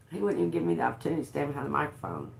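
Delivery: ordinary talk, nothing marked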